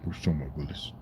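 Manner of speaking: quiet mock catchphrase aside; muttered catchphrase. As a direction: mumble the line under your breath.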